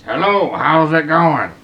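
puppet voice. Pretend. Voicing a skull as a dummy puppet.

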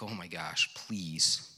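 low, soft retold plea; softly acted inner prayer; muttered plea to God. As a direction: plead quietly under your breath. Silent desperate prayer while waiting.

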